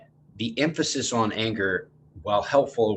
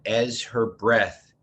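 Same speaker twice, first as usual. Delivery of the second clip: usual speaking voice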